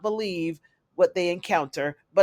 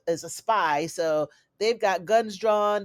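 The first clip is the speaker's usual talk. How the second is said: ordinary talk, nothing marked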